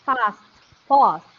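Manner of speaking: British "Fast" with a long open ah; drawn-back British vowel in "Fast"; British English pronunciation. Shown as a demonstration. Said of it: "according to British English".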